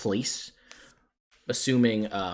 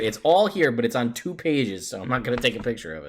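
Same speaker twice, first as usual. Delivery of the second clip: louder, exasperated outburst; snapping back at impatient players; 5 semitones higher, 11 dB louder than usual. Real, not acted.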